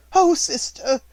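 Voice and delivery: a weepy sister's whimper; sniffing. A narrator's reading. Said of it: "she sniffed".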